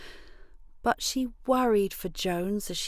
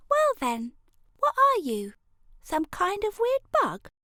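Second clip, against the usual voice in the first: put-on cheeky kid voice; 13 semitones higher, 3 dB louder than usual. A narrator's reading. Elray teases the grub.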